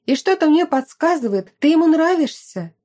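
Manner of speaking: animated and emphatic; lightly voiced friend. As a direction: press the hunch, voice lifted. Narrator voices teasing friend.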